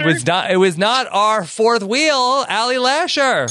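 high, drawn-out host announcement; guest introduction. Real, not acted. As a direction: announce the guest with a slow, rising build-up.

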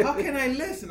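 hammed-up friendly airport worker; Mexican worker. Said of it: "He's like".

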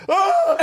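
high startled yelp; startled scream. Retold or imitated. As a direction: jolt awake with a yelp. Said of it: "I was like".

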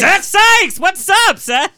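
high, loud cheering call; excited welcome. Real, not acted. Host welcoming guest Seth onto the show, loud cheering call. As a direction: shout his name like he's a star.

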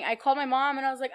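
ordinary talk, nothing marked

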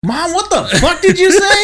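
high, loud, laughing mock yell; yelling from his room. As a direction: holler at mom from the next room. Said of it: "just yelling from your room".

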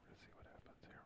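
hushed whisper so the caller can't hear; breathy whisper under the call. Real, not acted. Whispering during a phone call.

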